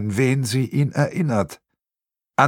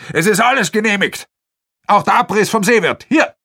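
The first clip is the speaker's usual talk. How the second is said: much higher, loud voiced official; pushy project man; 10 semitones higher, 4 dB louder than usual. Project man waves the permit at the protester, loud. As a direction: assert it smugly and loud.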